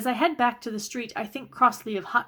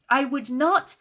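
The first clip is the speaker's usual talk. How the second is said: higher, louder indignant snap; snapping; 5 semitones higher, 5 dB louder, slower than usual. A narrator's reading. Kate denying she'd be hysterical, sharp angry snap. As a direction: bite the denial off sharply. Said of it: "I snap".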